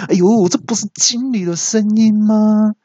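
high startled gasp of realisation; shocked. Retold or imitated. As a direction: caught out, alarmed. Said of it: "我听到之后就大吃一惊".

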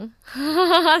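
high, loud giddy burst; giddy laugh. Real, not acted. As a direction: laugh with leftover excitement. Recalling the happy morning.

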